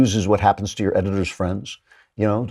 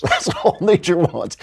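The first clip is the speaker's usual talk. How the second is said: laughing; 9 semitones higher than usual. Real, not acted.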